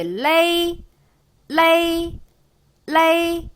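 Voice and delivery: 레이 high with the weight on 에; pinyin lei demo. Shown as a demonstration. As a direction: say 레이 three times, clean and even. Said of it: "에이, 시작".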